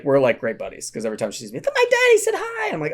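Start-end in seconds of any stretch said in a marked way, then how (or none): 1.6-2.7 put-on much higher, a chirpy little girl. Retelling the camper girl's greeting in a high little-girl voice. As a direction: play the small camper greeting him.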